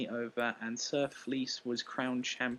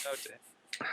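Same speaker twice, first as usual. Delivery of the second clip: whispered; 7 dB quieter than usual. Real, not acted. Sympathy for co-host's hiccups.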